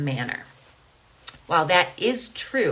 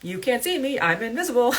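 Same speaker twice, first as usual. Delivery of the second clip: a lightly voiced child teasing, higher and sing-song; playful kids; 6 semitones higher, 4 dB louder than usual. Mother imitates her kids joking about being invisible, lightly playful. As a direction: mimic the kids' game, bright and teasing. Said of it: "My kids joke, they're like".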